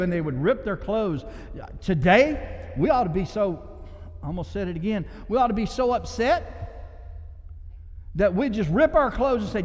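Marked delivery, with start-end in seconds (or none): none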